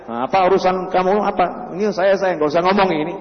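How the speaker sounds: ordinary delivery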